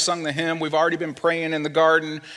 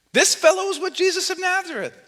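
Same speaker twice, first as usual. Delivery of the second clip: very high put-on girl's voice; high, ringing accusation on a held pitch; accusing servant girl. Servant girl telling the people there about Peter, accusing.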